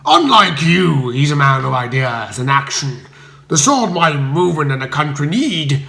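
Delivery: a bully looking down on Bertie; hectoring leader, loud and scornful; piercing scorn. A narrator's reading. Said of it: "with a piercing stare".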